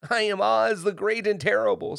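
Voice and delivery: high, loud, frightened little man; timid, high-pitched announcement; trembling voice. A narrator's reading. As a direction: blurt it out in alarm. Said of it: "in a trembling voice".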